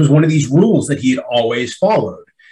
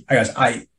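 normal talking voice

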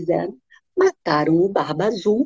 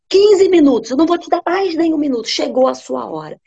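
much higher, loud and fast husband; furious refusal; 7 semitones higher, 4 dB louder than usual. Acted. Bluebeard refusing his wife more time, high, loud and fast. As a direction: cut her plea dead.

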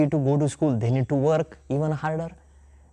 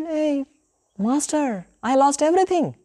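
lamenting; 10 semitones higher than usual. Acted. Narada lamenting to Krishna.

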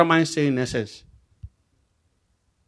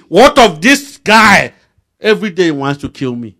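angry complaint; 3 semitones higher, 8 dB louder than usual. Retold or imitated. Angry man pointing at preacher.

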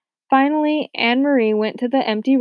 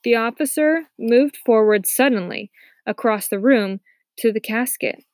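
ordinary delivery

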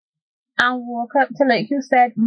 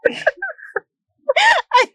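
cracking up mid-sentence; breaking into high laughter; laughing; 8 semitones higher, slower than usual. Real, not acted. Giggling fit over the spitting.

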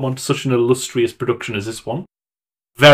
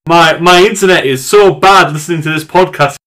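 louder, higher comic rant; raised-voice mock complaint; retold exasperated listener; 5 semitones higher, 4 dB louder than usual. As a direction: act out a listener griping in exasperation. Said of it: "then it was like".

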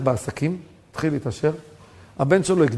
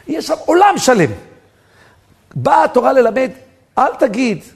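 raised, emphatic preaching voice; rising preaching; 7 semitones higher, 6 dB louder than usual. Acted. Preacher driving home his point, raised and emphatic. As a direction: build the point to a peak.

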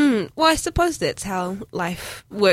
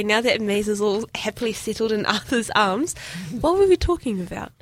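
ordinary delivery

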